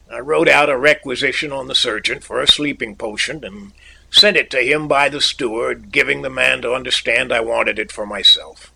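usual speaking voice

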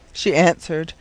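usual speaking voice

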